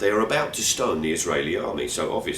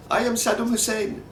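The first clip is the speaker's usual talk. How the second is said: much higher put-on voice for the taxi driver; driver's introduction. Taxi driver introducing himself to the walkers, high grand flourish. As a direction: announce the name with a grand flourish.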